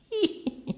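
thin, very high acted squeal. A narrator's reading. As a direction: let out a sudden shocked squeal. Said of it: "gridò Barbagallo". (strillo acutissimo recitato)

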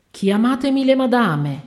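urgent summons. Acted. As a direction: call out for the ladies urgently. Pale worker orders at door. (richiamo forte e acuto)

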